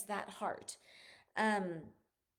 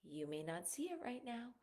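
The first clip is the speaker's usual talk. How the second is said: usual speaking voice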